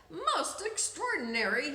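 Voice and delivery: amazed doctor's exclamation; a touch of wonder; exclaiming. A narrator's reading. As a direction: marvel at it like a puzzled doctor. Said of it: "he exclaimed".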